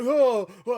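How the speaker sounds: high, loud mock groans of waking up; mock groaning. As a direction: ham up a pained waking groan. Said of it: "He's like".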